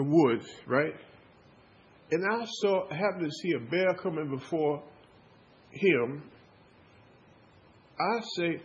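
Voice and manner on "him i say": ordinary talk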